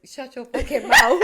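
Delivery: slow, loud, laughing "shut your fucking mouth"; quoting the angry little brother with laughter; mock brother snapping. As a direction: spit the little brother's line back, hammed-up. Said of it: "he gonna say".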